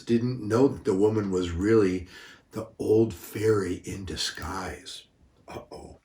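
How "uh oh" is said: breathy whispered uh-oh. A narrator's reading. Storyteller whispers to the children, worried hush.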